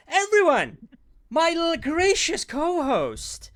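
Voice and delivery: loud, high, drawn-out show introduction; announcer introduction. Pretend. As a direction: slowly belt out the co-host's big entrance. Announcing his co-host to the audience.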